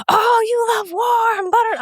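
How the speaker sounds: mock shrieking critic. Mocking angry commenters online, hammed-up high shriek. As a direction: squeal it like an outraged online critic.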